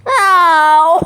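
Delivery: yelp. Real, not acted. Foot hurt while laughing.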